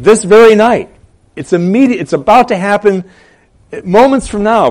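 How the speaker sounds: high, loud "This very night"; emphatic. Acted. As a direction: punch "very night" and "about to happen". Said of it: "he was emphatically saying".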